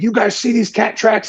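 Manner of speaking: mock the worked-up guy's excitement; fired up. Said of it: "He was all fired up about it".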